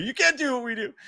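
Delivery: lightly mimicked band line, high-pitched. Host quoting the band at his viewers, light mimicry. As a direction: toss out a high mock band quote. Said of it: "I would say the Blink one eighty-two".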